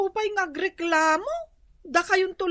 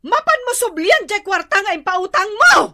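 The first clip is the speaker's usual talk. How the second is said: angry shout; 3 semitones higher, 7 dB louder than usual. Acted. Wife yells at husband over loan.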